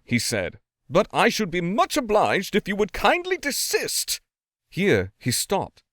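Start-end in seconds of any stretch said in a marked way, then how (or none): 0.9-4.2 high, stiffly polite fury; angry. A narrator's reading. Lawyer ordering the noise stopped, in angry politeness. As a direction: demand it stop through clenched politeness. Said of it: "very angry he looked".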